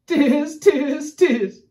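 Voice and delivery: loud monkey cries, high then dropping; high, loud storybook monkey chant; chattering monkeys. A narrator's reading. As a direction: mimic cheeky monkeys jeering back. Monkeys mimic the shouting peddler.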